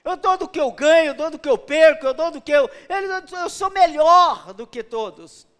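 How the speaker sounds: boastful proud man. Retold or imitated. Preacher mocking a proud tither, loud boastful brag. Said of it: "ele falou assim".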